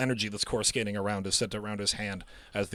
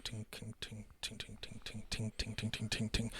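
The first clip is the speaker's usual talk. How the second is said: soft, light, repeated tings; tinkling metal; 16 dB quieter than usual. Acted. Game master imitating metal tinkling from robes, very soft. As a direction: tick them out quietly like falling metal. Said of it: "metal starts to ting".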